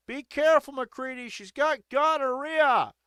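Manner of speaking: high, loud mock warning; Derek. Friend warning McCready about her, high-pitched alarm. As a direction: call it out high, stretching the last phrase. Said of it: "Derek's like".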